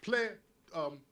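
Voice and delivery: ordinary talk, nothing marked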